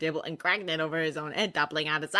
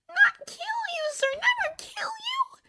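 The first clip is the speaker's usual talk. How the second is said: very high whispered protest; a horrified elf denying it; shocked elf; 4 dB louder, slower than usual. A narrator's reading. Dobby shocked at Harry's accusation, high and whispery.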